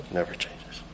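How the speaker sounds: hushed whisper, almost breathless; whispering it like a secret truth; whispered refrain. Acted. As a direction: let the last echo fall to a whisper. Refrain repeated, dropping to whisper.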